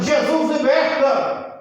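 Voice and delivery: high, drawn-out shout; preaching cry. Acted. Stones preach behind the listener, slow high proclamation. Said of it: "umas pedras pregando".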